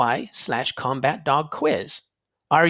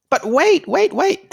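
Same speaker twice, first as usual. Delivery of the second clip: much higher and louder, an eager cry; excited interruption; 13 semitones higher, 6 dB louder than usual. Acted. Host calling kids back for a bonus quiz, excited high cry. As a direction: stop them from leaving, all excited. Story ends, bonus announced.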